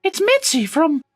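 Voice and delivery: a high, squeaky game-show introduction; host announcer voice. Acted. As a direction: present it like a cheery host.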